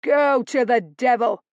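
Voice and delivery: loud defiant yell; shouted. Acted. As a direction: spit it back at him. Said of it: "Nate shouted".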